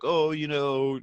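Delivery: retold tearful moan; mock crying. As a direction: lightly imitate someone crying and upset. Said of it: "he's, like, crying".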